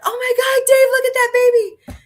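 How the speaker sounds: put-on high, loud squeal of "Oh my God, Dave, look at that baby"; excited squeal. Telling Dave about the kitten in a high excited squeal. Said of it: "I screamed".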